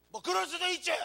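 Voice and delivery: high, loud defensive brush-off; sulky shrug-off. Retold or imitated. Husband dodging an apology, high, loud and defensive. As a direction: toss it off like a sulky teen. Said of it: "남자들은 대처럼 미안하면".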